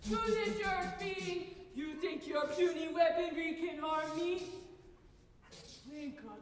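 booming evil alien overlord; megalomaniac rant. Pretend. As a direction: gloat like a villain. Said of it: "You're an alien".

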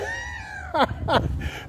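sudden high-pitched giggle; high squealing laugh. Real, not acted. Laughing at going over time.

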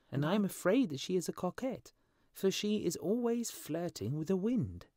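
high-pitched little bird character; complaining swallow. A narrator's reading. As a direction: grumble as a small lovelorn swallow. Swallow complains of his love.

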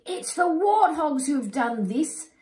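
high, loud accusing cry. Acted. Animals accusing the warthogs, high loud cry. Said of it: "cry the other animals".

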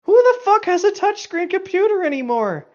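normal talking voice

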